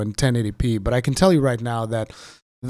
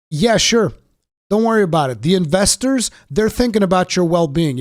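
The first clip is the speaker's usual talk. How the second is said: sarcastic; 6 semitones higher, 4 dB louder than usual. Real, not acted. Sarcastic about investors.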